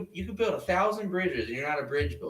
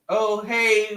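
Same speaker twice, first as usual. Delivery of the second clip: usual speaking voice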